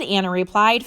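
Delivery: ordinary delivery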